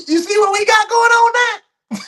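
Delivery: laughing in disbelief, up high; laughing, high and loud in disbelief. Real, not acted. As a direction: laugh it out, incredulous.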